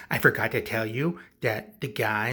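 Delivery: ordinary delivery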